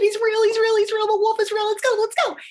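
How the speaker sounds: high, loud, frantic squeal on one note; panicked pig yelling to flee, words tumbling out; urgent high-pitched alarm; panicked warning. A narrator's reading. Panicked pig warning her sisters.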